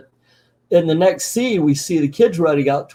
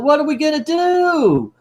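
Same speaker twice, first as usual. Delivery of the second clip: very high-pitched put-on kid voice; mock despairing kid; 13 semitones higher than usual. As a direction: wail it like a helpless cartoon kid. Same cartoon kid despairing.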